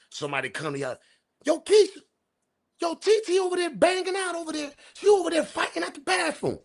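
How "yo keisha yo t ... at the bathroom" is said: put-on high-pitched girlish voice, urgent; mocking female friend voice. Girl in the club telling Keisha about a fight, high and urgent. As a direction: squeal it out like a girl bringing bad news.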